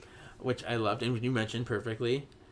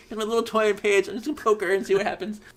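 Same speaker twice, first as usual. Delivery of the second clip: higher-pitched playful teasing; playful mock Cole; 8 semitones higher, 3 dB louder than usual. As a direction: imitate someone gleefully poking at a toy. Cole teasing a loopy Paige.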